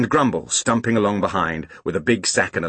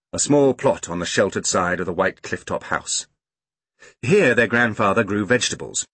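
ordinary delivery, nothing marked

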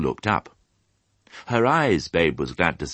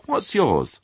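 high cheery piglet question; jolly piglet; 10 semitones higher than usual. A narrator's reading. Piglet asking the sheep's name, jolly. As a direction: ask it as a friendly little pig. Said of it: "he said in a jolly voice".

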